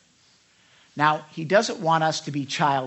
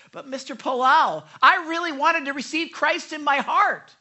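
put-on high, loud little girl's voice, sure of herself; confident little girl; 10 semitones higher, 8 dB louder than usual. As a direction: speak up boldly like a young girl. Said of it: "and confidently said".

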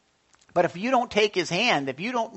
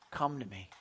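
gentle Jesus; 5 semitones lower, 11 dB quieter than usual. Acted. I picture Jesus reaching down.

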